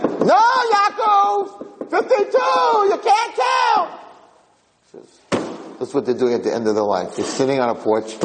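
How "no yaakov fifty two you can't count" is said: very high, drawn-out screechy shout; mock old-lady yelling. Old woman yelling at Yaakov over counting buses, very high mock shriek. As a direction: shriek the correction in a put-on squabbler's voice. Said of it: "they're yelling and calling each other names".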